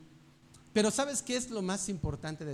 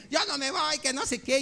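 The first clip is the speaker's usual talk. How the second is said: high-pitched mock little-boy whine; imitated child's tantrum; tantrum-throwing child; 6 semitones higher than usual. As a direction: act out a kid digging in, pitched way up. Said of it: "Y empieza a hacer su berrinche".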